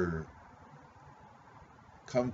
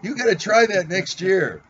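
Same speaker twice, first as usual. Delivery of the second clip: loud, high call to Mark; 8 semitones higher, 9 dB louder than usual. Real, not acted. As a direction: shout it across to Mark. Calling out to absent friend.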